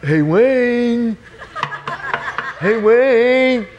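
high, loud, drawn-out call; pestering night-time call through a door; Mike. Retold or imitated. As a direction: stretch the name out, high and insistent. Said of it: "then I hear this voice".

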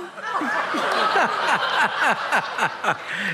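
real laugh at his own punchline. Real, not acted.

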